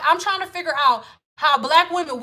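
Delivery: usual speaking voice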